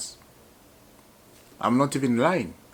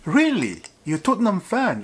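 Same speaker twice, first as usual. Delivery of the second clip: higher and louder astonished question; surprised; 8 semitones higher, 5 dB louder than usual. Retold or imitated. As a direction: act stunned he backs Tottenham. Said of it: "And I was like".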